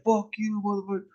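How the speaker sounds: high angry comeback; kid yelling back. Retold or imitated. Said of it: "Ben's like".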